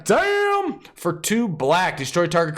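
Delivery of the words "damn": very high, drawn-out whoop; exaggerated exclamation. Host reacts to the next card, exaggerated high exclamation. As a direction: stretch it into a high cry. Exclaims over a card's name.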